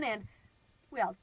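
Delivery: normal talking voice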